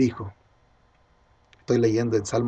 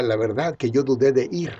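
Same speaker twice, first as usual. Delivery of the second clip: normal talking voice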